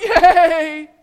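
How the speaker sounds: high laughing whoop; laughing cheer. Real, not acted. Dad cheering as the front tires come free, laughing whoop.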